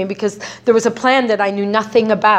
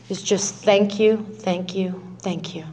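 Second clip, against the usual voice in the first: softer with each thank you; fading prayer; 3 semitones lower, 6 dB quieter, slower than usual. Retold or imitated. Quoting Bill's best prayer, fading to a hush. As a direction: let the thank yous fade away. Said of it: "And he said".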